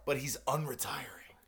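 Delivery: soft, slow whispered aside. Real, not acted.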